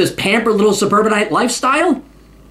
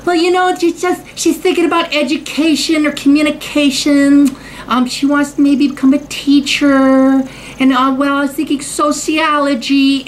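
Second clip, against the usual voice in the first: mock girl voice; 4 semitones higher than usual. Quoting a girl at coffee store.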